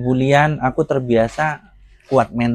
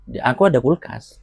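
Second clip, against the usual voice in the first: ordinary talk, nothing marked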